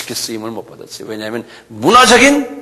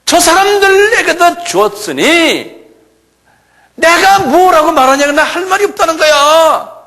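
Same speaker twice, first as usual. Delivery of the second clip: preaching at full force; 12 semitones higher than usual. Acted. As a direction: drive the point home to the congregation. Fiery sermon passage.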